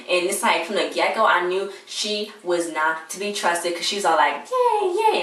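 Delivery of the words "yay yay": much higher squeaky yay, yay; mock the overly positive lawyer's cheer; mock perky cheer. Vlogger mocking the lawyer's over-positive cheer, high and squeaky. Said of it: "and all positive".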